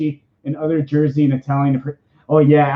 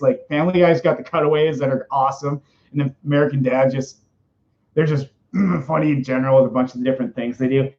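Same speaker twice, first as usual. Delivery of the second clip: ordinary talk, nothing marked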